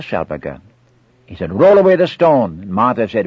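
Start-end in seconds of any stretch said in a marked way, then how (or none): 1.5-2.6 loud, raised order; commanding call. Acted. Jesus at Lazarus's tomb, commanding the bystanders. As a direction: call it out with authority.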